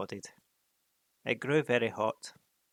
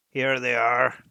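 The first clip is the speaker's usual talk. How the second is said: booming; 11 dB louder, slower than usual. Acted. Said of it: "he said to the horses".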